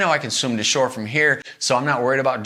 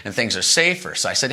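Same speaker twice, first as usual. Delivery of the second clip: ordinary delivery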